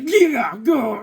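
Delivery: high excited yell; wild transformed yell; high shout. Retold or imitated. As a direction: let it burst out loud and high. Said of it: "He's like".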